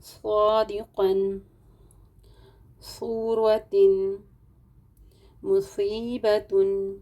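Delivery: صادقاً صورتن مصیبتن drawn out very slowly, each syllable held; slow recitation. Shown as a demonstration. Teacher reciting Qaida words to students, very slow and drawn out. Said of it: "یہاں پر دیکھیے".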